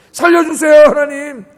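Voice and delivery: high, loud, begging cry to God; 절박하게 매달리는 기도; desperate pleading prayer. Shown as a demonstration. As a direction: plead for your life, voice rising. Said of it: "기도는 이렇게 하는 거예요".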